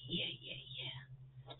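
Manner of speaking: soft whispered yeahs; whispering. Real, not acted. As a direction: breathe it under the breath. Whispered aside.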